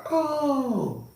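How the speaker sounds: high, drawn-out falling "oh"; imitated spirit's "oh". Spirit drinking his potion and reading a visitor, high imitated moan. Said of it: "and he like".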